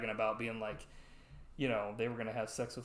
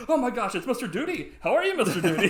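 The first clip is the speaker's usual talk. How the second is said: high, loud mock gushing, breaking into laughter; fawning delight; 11 semitones higher, 12 dB louder than usual. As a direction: switch to sucking up to a VIP. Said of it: "he was, like, kissing his ass".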